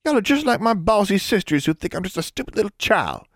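put-on very high, upset child's outburst; tearful Southern girl, shrilly protesting; barely controlling tears. A narrator's reading. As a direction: wail it like a hurt little sister. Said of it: "barely controlling her tears".

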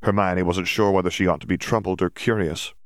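plain reading voice

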